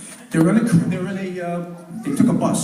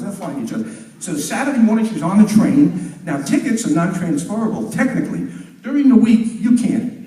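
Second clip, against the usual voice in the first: ordinary talk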